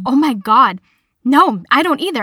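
imitating a classmate gushing in agreement; retold relief. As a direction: burst out, glad to share the confusion.

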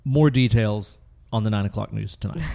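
normal talking voice

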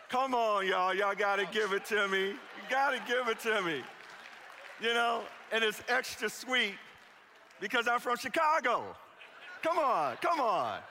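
loud, high, playful begging; pleading with crowd. Real, not acted. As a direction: coax the crowd to give it up.

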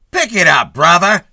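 loud, high barking cop; snapping cop. A narrator's reading. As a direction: bark it at him like a traffic cop. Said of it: "snapped a cop".

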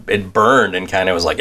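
ordinary delivery

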